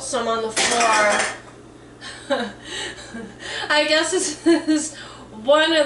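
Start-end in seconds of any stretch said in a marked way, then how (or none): 2.0-3.6 soft laugh. Real, not acted. Laughing at spilling on the floor.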